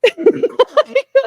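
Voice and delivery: high, loud laugh; laughing out loud at the absurdity; burst of laughter. Real, not acted. Laughing at patronizing men.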